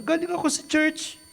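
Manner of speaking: high, whiny fake-innocent excuse; mock dramatic teen excuse. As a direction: play the guilty kid pleading innocence. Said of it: "magdadrama-drama".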